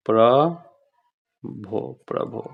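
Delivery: प्रभो drawn out very slowly for dictation; teacher dictating प्रभो slowly. Shown as a demonstration. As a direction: stretch प्रभो out syllable by syllable. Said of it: "Prabhu".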